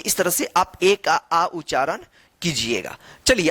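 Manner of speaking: ordinary delivery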